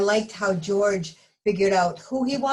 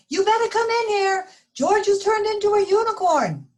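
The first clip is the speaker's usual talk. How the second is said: higher, louder alarmed call; yelling mom; 6 dB louder than usual. Acted. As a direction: shout the news across the house. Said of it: "Mom yelled".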